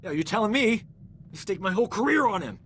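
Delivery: exasperated. Acted. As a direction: vent your frustration upward. Exasperated after patient's death.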